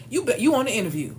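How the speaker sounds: ordinary talk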